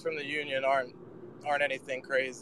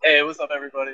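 normal talking voice

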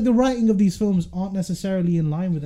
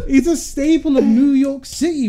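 put-on slow, louder New York accent, higher; 6 semitones higher, 4 dB louder than usual. Host lost in New York accent insisting on staple. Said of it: "I lose myself".

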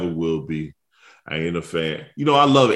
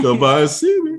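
imitated invitation; 8 semitones higher, 6 dB louder than usual. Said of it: "Went from".

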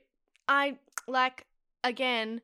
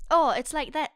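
higher, louder attempt that drifts Irish; Irish-sounding accent; 7 semitones higher, 7 dB louder than usual. Shown as a demonstration. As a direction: fumble into an Irish accent, amused. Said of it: "like, Irish, isn't it?".

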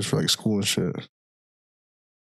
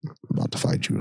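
whispered threat; 4 dB quieter, faster than usual. Acted.

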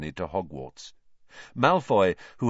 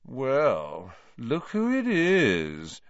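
high, drawn-out sneering drawl; slow, lazy drawl rising high; 4 semitones higher, much slower than usual. A narrator's reading. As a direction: stretch the words out lazily, pitched up high. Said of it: "in his usual lazy drawl".

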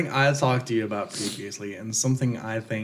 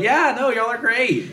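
high, bright, oblivious praise; chirpy dismissive approval; clueless cheer; 13 semitones higher, 5 dB louder than usual. Retold or imitated. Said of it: "they're still like".

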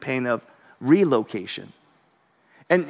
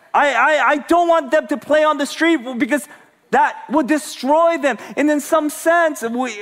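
raised, impassioned preacher voice; preaching; 8 semitones higher than usual. Acted. Pastor urging the congregation, impassioned preaching. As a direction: preach it out high and strong to the room.

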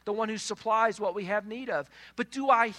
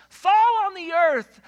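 higher, louder, slow majestic command; commanding God voice; 9 semitones higher, 10 dB louder, slower than usual. Acted. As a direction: speak as God commanding the snow. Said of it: "God says to the snow".

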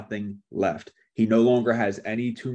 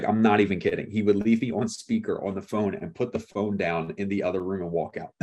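ordinary delivery

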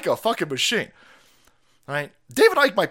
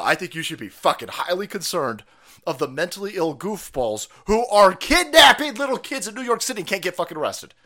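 usual speaking voice

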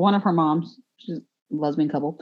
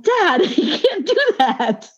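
higher, laughing, slow scolding of dad; laughing, pleading with dad; 12 semitones higher, slower than usual. Retold or imitated. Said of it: "I'm like".

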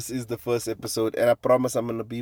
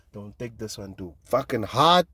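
ordinary talk, nothing marked

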